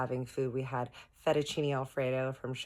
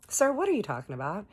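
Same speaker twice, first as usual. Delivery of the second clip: higher, quick mock-indignant challenge; indignant retort; 7 semitones higher, 3 dB louder, faster than usual. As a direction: fire back in retold disbelief. Retold quarrel, challenging Bob.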